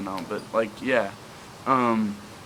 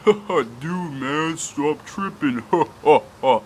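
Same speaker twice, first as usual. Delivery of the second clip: mocking friends; 3 semitones higher, 3 dB louder, slower than usual. Friends laughing at the tripping narrator, mocking laughter. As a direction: tease him through giggles, slowly. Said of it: "They're like".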